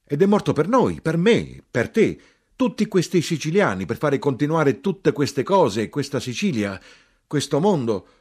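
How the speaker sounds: high, impassioned tirade. A narrator's reading. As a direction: cry out each phrase, loud and insistent. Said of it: "Ancora più forte gridai".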